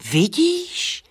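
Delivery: sudden very high squeak; a bat's high excited squeak. Acted. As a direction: squeak it like a delighted bat. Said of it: "pískl netopýr".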